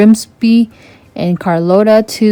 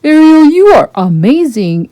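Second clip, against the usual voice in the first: higher, louder and warmly impressed; admiring prince; 8 semitones higher, 5 dB louder than usual. A narrator's reading. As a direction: praise her with delight. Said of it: "Eric said".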